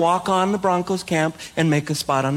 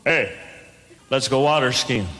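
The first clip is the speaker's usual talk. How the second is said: low-pitched imitation of a local guy; Minnesota guy; 6 semitones lower than usual. Local guy invites newcomer water skiing, low imitated voice. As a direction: put on a lower voice for the casual invitation. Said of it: "Guy comes up and goes".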